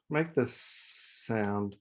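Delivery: "sound" opened with a long, soft hissed s; slow down and sustain the s of "sound"; drawn-out S. Shown as a demonstration. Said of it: "make a soft, gentle s-, sound".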